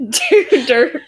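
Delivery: words broken by laughter; laughing. Real, not acted. Player laughing while describing the dirt piles, laughing.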